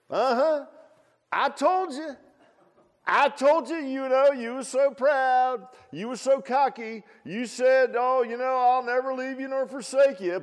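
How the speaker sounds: very high, loud teasing scold; mock scolding Jesus. Jesus scolding proud Peter, very high and loud. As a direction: rub it in, hammed up.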